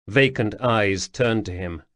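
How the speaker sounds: ordinary delivery, nothing marked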